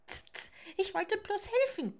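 high, huffy squirrel voice; tut, then protest in a high voice; offended chittering. Acted. Squirrel only wanted to help, offended and chittering. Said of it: "kickerte das Eichhörnchen beleidigt".

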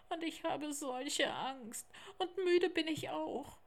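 put-on high, childlike tiger cub voice; eager little cub, turning tired and woeful; little tiger, earnest then woeful. Theo telling Lars how he got lost, high little tiger voice.

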